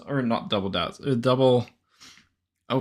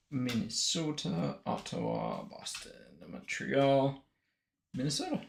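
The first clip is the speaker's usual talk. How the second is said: muttered to self; 11 dB quieter than usual. Real, not acted. Muttering teams while sorting cards.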